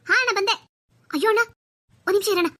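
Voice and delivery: loud, high answering call; calling back. Acted. Sister answering her brother that she's coming, calling back.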